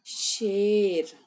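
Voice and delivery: put-on teacher draws out शेर for the children; drawn-out model word. Shown as a demonstration. Teacher names the jungle king for pupils, slow drawn-out demo. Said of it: "जिसे कहते हैं हम".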